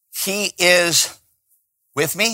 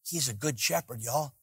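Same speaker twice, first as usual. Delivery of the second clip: much softer, gentle and sincere; quiet affirmation; 15 dB quieter than usual. Real, not acted. Preacher quietly assuring the congregation, in a soft tender voice. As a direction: say it tenderly, as a comfort.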